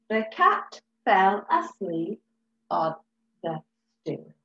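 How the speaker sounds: "The cat fell asleep on the stool" read slowly, word by word; check-reading, each word apart; slow reread. Shown as a demonstration. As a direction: reread to check it makes sense. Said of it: "reread it".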